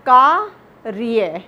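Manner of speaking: कार्य drawn out slowly, र trilled; slow demo pronunciation. Shown as a demonstration. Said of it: "तो इसका उच्चारण होगा". (कार्य धीरे-धीरे, र में कंपन के साथ)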